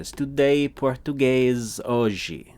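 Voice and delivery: Portuguese sentence read slowly for learners; slow Portuguese model. Shown as a demonstration. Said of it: "You would say".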